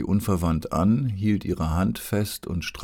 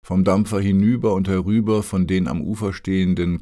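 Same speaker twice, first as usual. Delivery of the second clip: usual speaking voice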